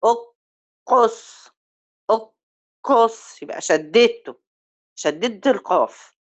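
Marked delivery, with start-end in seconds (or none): 0.0-3.4 heel traag أقص met de q stevig verdubbeld en hard gestopt; qaf met shadda. Shown as a demonstration. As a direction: zeg أقص traag en druk de q dubbel aan. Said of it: "أنا عايزة أجيب مخرج القاف".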